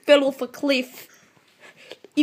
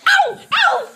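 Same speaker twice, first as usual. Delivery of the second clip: pained yelp; 14 semitones higher, 4 dB louder than usual. Real, not acted. Stung by a bee in game.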